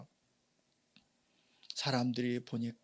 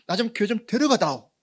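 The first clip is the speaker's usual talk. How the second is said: "데려가다오" pleaded high and loud; eager plea; 9 semitones higher, 9 dB louder than usual. Retold or imitated. Friend begging to be taken to church, loud and high. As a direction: beg "데려가다오" with rising urgency, much louder. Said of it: "지금 말로 어떻게 말할 수 있나요".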